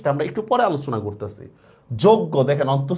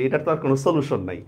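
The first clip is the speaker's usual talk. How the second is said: usual speaking voice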